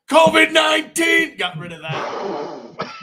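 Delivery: drawn-out shout, slow and high; mock outraged shout. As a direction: bellow it out slowly in mock outrage. Comic outburst about covid.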